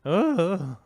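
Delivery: loud, high cracked "ah"; mock voice crack. Shown as a demonstration. Joking about his own puberty crack, loud high squawk.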